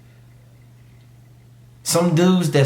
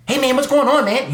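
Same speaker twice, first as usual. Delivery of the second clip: higher, louder mock dorky greeting; mocking a corny dude; 9 semitones higher, 4 dB louder than usual. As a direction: play the uncool guy, overly chipper. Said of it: "You don't want guys talking like that".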